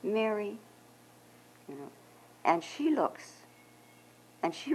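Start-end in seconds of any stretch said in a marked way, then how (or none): 0.0-0.6 quiet, loving call of her name; Jesus lovingly speaks Mary's name. Acted. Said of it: "all of this love is in his voice".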